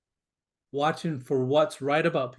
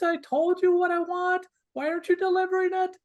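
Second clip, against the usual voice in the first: very high-pitched mock whining; whiny voice; 14 semitones higher than usual. Coach mimicking a partner let down in love, whiny. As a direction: put on a high, complaining little whine. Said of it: "this little whiny voice".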